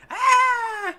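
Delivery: high, loud imitation of screaming girls; mimicked scream. Drenched girls screaming at the prankster, mimicked scream. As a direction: let out a high mock shriek. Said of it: "empezaron a gritar mi nombre".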